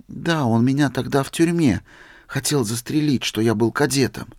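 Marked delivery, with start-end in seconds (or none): none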